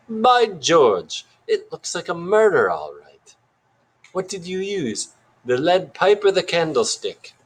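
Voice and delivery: high, hammed-up British sleuth; weird British accent. A narrator's reading. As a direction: exclaim and inquire like a stagey British detective. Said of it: "still in that weird accent".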